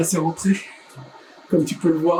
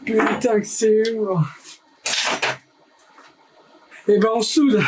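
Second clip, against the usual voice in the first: strained, high, slow effort voice; groaning while lifting heavy; straining under weight; 5 semitones higher than usual. Real, not acted.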